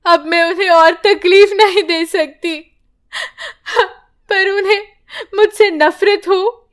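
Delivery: tearful confession to God; sad. Acted. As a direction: speak through sorrow. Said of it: "Padma दुखी होकर कहती है".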